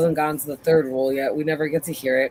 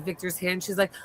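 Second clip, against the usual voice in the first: normal talking voice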